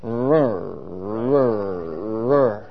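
low rumbling imitation of a cold engine turning over; engine sputter. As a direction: growl it out like a car that won't start. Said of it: "I start, and I go".

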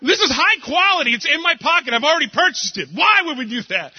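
high, rising mock rant; arguing against the photo booth, hammed-up high. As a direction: whine it in a high comic voice. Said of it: "She's like".

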